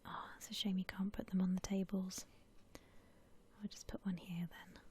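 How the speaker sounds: usual speaking voice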